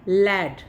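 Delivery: लैड repeated loudly, the vowel an open ae; teacher repeats लैड; clear lad. Shown as a demonstration. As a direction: model लैड for the class to copy. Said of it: "लैड का मतलब होता है लड़का".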